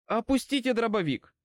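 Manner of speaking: high, urgent command. A narrator's reading. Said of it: "скомандовал я". (командным тоном)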